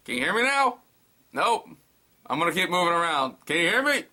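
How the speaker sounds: walking around for signal, calling out. Acted. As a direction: shout over a bad line. Phone call with bad signal.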